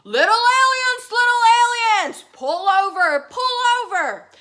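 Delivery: big bad robot shouting orders. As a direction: yell it down at them. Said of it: "the big bad robot said".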